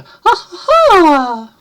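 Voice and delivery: very high, loud, fake-feminine fairy trill; airy fairy "ha" sung out high. Shown as a demonstration. Vlogger mocking own too-fake female voice, high fairy voice. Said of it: "type voice".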